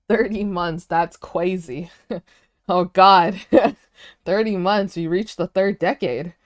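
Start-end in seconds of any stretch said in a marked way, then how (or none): none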